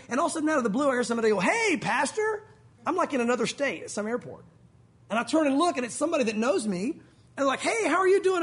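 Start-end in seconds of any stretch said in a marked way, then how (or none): 1.4-2.4 high, drawn-out friendly hail; calling out. Retold or imitated. Somebody spots the pastor at an airport, calling out. 7.6-8.4 high, loud hello; gushing greeting. Retold or imitated. Somebody who knows the pastor greets him, gushing hail.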